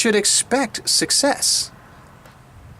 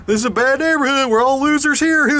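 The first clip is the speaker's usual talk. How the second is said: high, louder put-on cop voice; mocking lazy cop; 6 semitones higher, 3 dB louder than usual. Cop dismissing the speaker's report, high mocking voice. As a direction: shrug it off like a cop who gave up. Cop mocked in retold scene.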